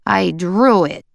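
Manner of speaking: put-on slow, sulky little boy; cranky toddler. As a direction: grumble it like a tired child. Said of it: "Will said, cranky".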